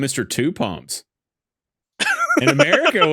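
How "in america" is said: laughing burst. Real, not acted. Mock-patriotic joke, laughing.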